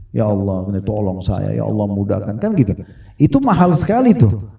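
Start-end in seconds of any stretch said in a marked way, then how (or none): 0.1-2.3 mild tearful prayer. Retold or imitated. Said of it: "mudah sekali kita mewek sama Allah, nangis".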